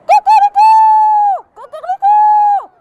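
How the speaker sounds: shrill crowing cock-a-doodle-doo, high and loud; rooster crow. Acted. The rooster crows at the well, loud and high. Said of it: "Et il crie".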